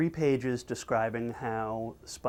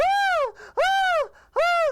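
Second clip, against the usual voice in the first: piercing, very high loud shriek; cartoon scream; 6 dB louder, much slower than usual. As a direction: scream at full pitch like a terrified cartoon. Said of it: "the screaming is still going on".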